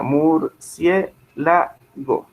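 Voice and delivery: murciélago split into syllables, very slow; separated syllables. Shown as a demonstration. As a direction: pull murciélago apart slowly, syllable by syllable. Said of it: "Es de esta manera". (murciélago separado en sílabas, muy despacio)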